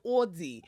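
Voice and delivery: "Aldi." drawn out as AWL-dee; particular way of saying Aldi. Shown as a demonstration. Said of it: "I have to say it like that".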